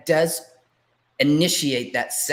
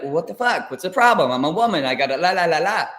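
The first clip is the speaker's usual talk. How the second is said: mock indignant outburst, loud and higher; loud and proud; 3 semitones higher, 5 dB louder, faster than usual. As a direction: retell a brash protest, trailing into la-la babble. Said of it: "I used to be very loud and proud".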